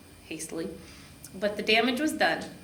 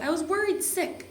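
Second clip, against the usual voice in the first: yelling; 9 semitones higher than usual. A narrator's reading. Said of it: "I yelled".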